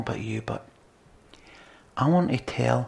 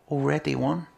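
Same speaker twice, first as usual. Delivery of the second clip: ordinary talk, nothing marked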